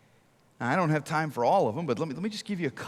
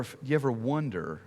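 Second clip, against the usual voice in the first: ordinary talk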